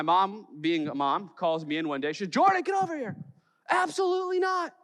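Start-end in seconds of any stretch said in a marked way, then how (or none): 2.3-3.2 high, loud mock mom call; a playful send-up of Mom yelling. Mom voiced in a story. 3.7-4.7 high mock mom shout; a hammed-up mom's flat no; mom refusing.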